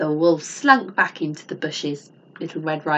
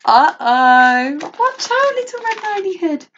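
excited warning; 11 semitones higher, 8 dB louder than usual. Acted. Teacher warning the children.

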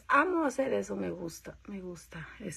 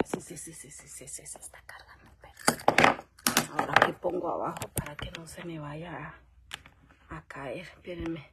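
whispering while plugging in the charger; hushed whisper to herself; 4 semitones lower, 8 dB quieter than usual. Real, not acted. Whispering to her live viewers while she fixes the charger.

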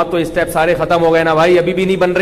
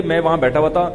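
normal talking voice

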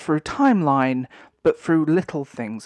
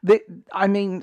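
normal talking voice

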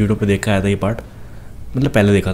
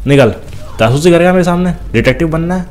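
much higher, louder scornful jeer; a loud sneer at the snooping enemy; gloating taunt; 7 semitones higher, 3 dB louder than usual. Real, not acted. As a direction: scoff at the would-be detective.

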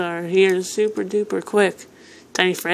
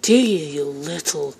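normal talking voice

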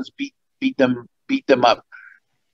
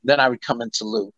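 ordinary talk, nothing marked

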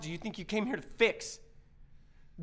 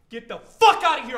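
loud, explosive order to leave; furious shout; 12 dB louder than usual. Acted. Man ordering intruder out of trouble, furious shout. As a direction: bellow them out of the room.

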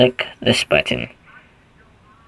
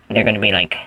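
ordinary delivery

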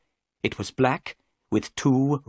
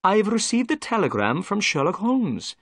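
higher, apologetic policeman voice; a proud officer owning up to a mistake; humbled inspector; 9 semitones higher than usual. A narrator's reading. Jones apologises to Watson for freeing Sholto, higher and contrite. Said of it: "the inspector went on".

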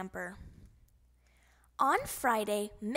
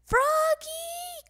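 long high loud call; 5 dB louder than usual. Acted. Mister Mugwort calling Froggy at the lake, long loud call. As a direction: call him across the lake. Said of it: "called Mister Mugwort".

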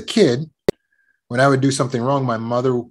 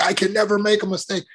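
higher-pitched anxious self-talk; exaggerated inner critic, pitched up; mock perfectionist; 6 semitones higher than usual. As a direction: parody a rigid perfectionist. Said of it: "get rid of the perfectionist mindset".

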